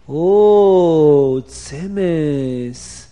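loud, drawn-out cry of delight; delighted discovery. Acted. Chaim Yankel finds the tzimis, loud delight. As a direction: call it out like finding treasure.

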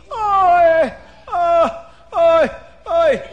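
high, very loud yelps of "oi"; hammed-up screams on waking battered; screaming in pain. A narrator's reading. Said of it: "Paroi wakes up screaming".

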